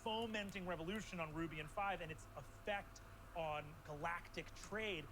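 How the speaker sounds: building storytelling narration; game master scene setting. Acted. GM sets the new scene.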